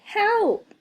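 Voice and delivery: high, loud call for help; panicky cry for help. A narrator's reading. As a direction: shout it out in a small panic. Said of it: "feeling a bit panicky".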